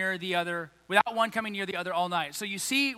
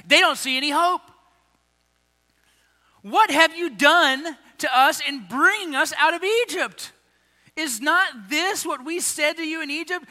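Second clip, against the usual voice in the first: raised, louder accusing voice; bitter complaint; 7 semitones higher, 5 dB louder than usual. Acted. Israelites accusing Moses, raised complaining voice. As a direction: throw the blame at Moses.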